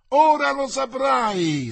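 high, loud, drawn-out yell; shrieked threat. A narrator's reading. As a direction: yell it slowly like a mob's threat. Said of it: "strillarono gli altri in coro". (strillo minaccioso, alto e prolungato)

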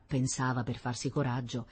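ordinary delivery

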